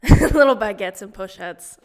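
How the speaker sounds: loud, laughing repeat. Real, not acted. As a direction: say it again through a laugh. Laughing repeat, much louder.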